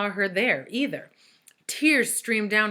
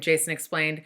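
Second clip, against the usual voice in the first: ordinary delivery, nothing marked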